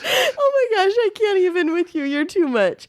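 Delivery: high, breathless laughing protest. Real, not acted. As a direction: gasp it out mid laugh. Overwhelmed by laughter.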